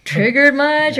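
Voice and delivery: mocking taunt.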